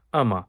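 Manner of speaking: "আমা" as one joined word; I am a blended into "আমা"; words run together. Shown as a demonstration. Teacher showing three words made one, "আমা" run together. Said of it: "এক শব্দ বানিয়ে ফেলেছি".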